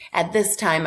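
ordinary talk, nothing marked

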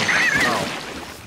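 ordinary talk, nothing marked

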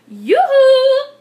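high, loud singsong hail; piercingly pitched holler; shouted call. A narrator's reading. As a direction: call across a meadow. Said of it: "she shouted".

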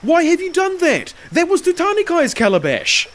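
high, loud outraged panic; half fearful, half angry cry. A narrator's reading. Slave protests the smashed calabash, half afraid, half angry. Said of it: "half in fear and half in anger".

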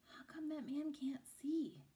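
put-on slow hushed child's whisper; slow secretive whisper. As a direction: ask it so he can't hear. Boy asks in whisper near blind man.

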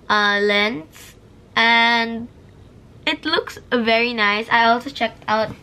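ordinary delivery

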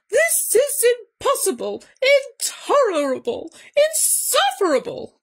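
high, outraged cry; angry disbelief. A narrator's reading. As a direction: hit each word with mounting exasperation. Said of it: "cried Bear, shaking with anger and disbelief".

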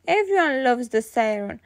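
usual speaking voice

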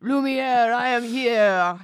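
loud, slow, hammed-up French candlestick; Lumiere voice. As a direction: announce yourself grandly like a French candlestick. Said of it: "rejected character from Beauty and the Beast".

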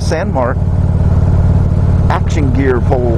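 talking loud over road and wind noise on a moving motorcycle. Real, not acted. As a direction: project the voice over the engine and wind. Talking while riding motorcycle in wind.

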